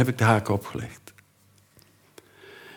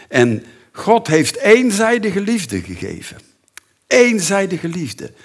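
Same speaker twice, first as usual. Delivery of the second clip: much higher, louder proclamation; preaching climax; 10 semitones higher, 5 dB louder than usual. Acted. Preacher proclaims God's one-sided love, high and loud. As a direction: lift it high like a preacher's peak.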